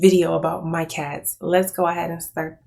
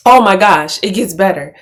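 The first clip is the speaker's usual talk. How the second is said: delighted outburst; 10 dB louder than usual. Real, not acted.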